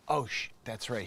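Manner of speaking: ordinary talk, nothing marked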